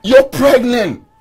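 high, slow, drawn-out protest; exasperated protest. Acted. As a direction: throw it back at them in exasperation. Heated quarrel over sharing a bed.

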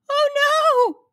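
high, loud wail of dismay. A narrator's reading. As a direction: wail it out loudly. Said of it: "he wailed".

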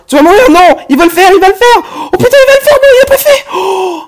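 high, loud panicked yelling; panicked shout. Real, not acted. Gamer watching the enemy near the goal, panicked shouting. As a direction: scream as the enemy nears the goal.

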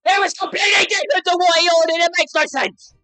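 high, loud furious yelling; real angry shout. Real, not acted. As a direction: scream it in outrage. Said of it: "I am so angry".

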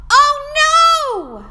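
high, loud cry of alarm; dismayed oh no. Acted. Teacher crying out as the alphabet falls. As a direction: gasp as the tree falls. Tree tips over.

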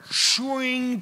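high ringing "shwing"; imitated sword noise. Preacher acting Saul drawing his sword, imitated swish. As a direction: mimic a blade sliding out.

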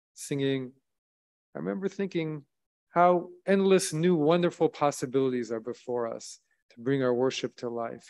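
normal talking voice

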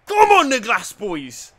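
stretched-out terrace shout; rallying cry. Real, not acted. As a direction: roar them on. Cheering his team on.

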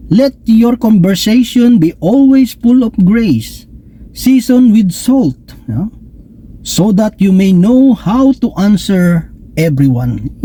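slow, measured scripture reading. Acted. Preacher quoting a verse to the congregation, slow and solemn. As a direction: read the verse slowly and solemnly.

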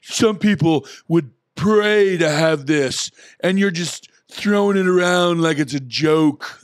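high, loud, slow self-righteous scold; mock outrage. Speaker mocks outraged commenters, high self-righteous voice. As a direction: parody a preachy online commenter. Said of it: "mocking outraged online commenters".

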